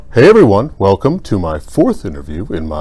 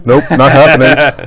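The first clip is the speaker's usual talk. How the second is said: slow, louder nope, not happening with a high push on happening; mock-stubborn refusal; 6 semitones higher, 6 dB louder, slower than usual. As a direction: drag out a pretend-firm refusal. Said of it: "firm refusal after apology bit".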